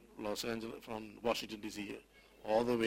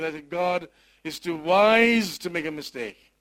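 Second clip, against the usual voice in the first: louder, higher pulpit proclamation; preaching at full force; 4 semitones higher, 7 dB louder than usual. Acted. Pastor exhorts the congregation from his grief, fervent preaching. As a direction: preach it out with conviction.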